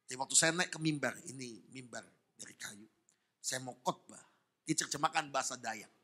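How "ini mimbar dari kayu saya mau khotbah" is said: normal talking voice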